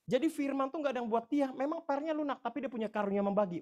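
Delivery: loud, high-pitched warning from the pulpit; preaching at full force. Real, not acted. (khotbah berapi-api, suara lantang dan tinggi)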